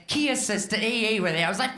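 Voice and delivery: ordinary talk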